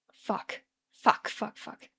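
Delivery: panicked curses under the breath; hushed cursing. Acted. Charlie hiding his panic from the Scottish man, hushed. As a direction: swear softly to steady himself. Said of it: "Charlie laughs".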